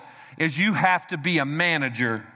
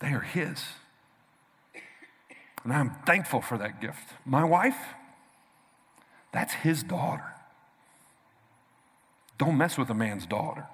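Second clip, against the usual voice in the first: preacher speaking of his sons and wife, hushed and tender; tender and low; 3 semitones lower, 5 dB quieter than usual. Real, not acted. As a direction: let it get personal and quiet. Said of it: "this one's hard for me".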